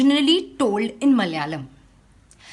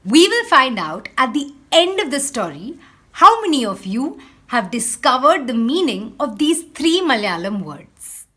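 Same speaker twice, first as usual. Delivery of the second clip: ordinary talk, nothing marked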